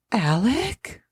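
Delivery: whispered in shock; a shocked whispered name; breathless whisper. Retold or imitated. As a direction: hush the name, stunned.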